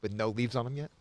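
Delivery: hushed golf announcer; soft, low tournament commentary. Pretend. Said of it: "gotta use our golf voice".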